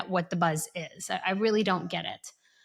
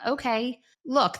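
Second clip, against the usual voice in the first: normal talking voice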